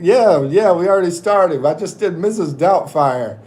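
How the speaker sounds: usual speaking voice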